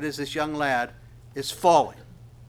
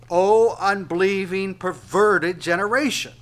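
raised, louder rebuke to the crowd; rebuking cry; 5 semitones higher, 8 dB louder than usual. Acted. Jesus rebukes the unbelieving generation in a louder, higher voice. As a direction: thunder the rebuke at a faithless generation. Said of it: "And Jesus answered and said".

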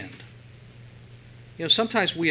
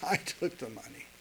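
sheepish confession; 10 dB quieter than usual. Retold or imitated. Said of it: "So he tells her".

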